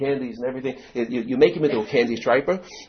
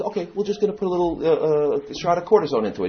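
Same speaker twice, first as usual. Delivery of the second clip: doctor voice; 7 semitones higher than usual. Retold or imitated. Doctor about to inject.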